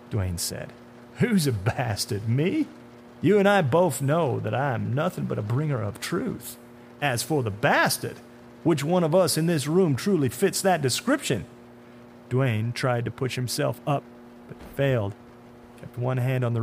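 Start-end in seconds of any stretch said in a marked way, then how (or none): 1.2-11.4 mock-offended, rising high in pitch; sinister man in dark suit. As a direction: throw the insult back with relish.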